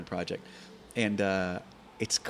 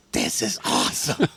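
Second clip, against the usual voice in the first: louder, delighted exclamation after the crash; thrilled kid; 8 dB louder than usual. Retold or imitated. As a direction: shout with joy like a hooked four-year-old. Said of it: "I get up".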